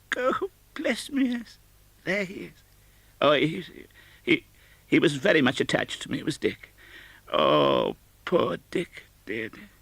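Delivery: fond and sad at once; wistful Scrooge. Acted. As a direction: Scrooge remembering poor Dick, tenderly. Scrooge remembers Dick.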